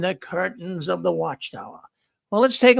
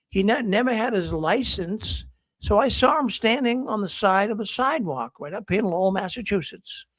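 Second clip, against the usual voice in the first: ordinary delivery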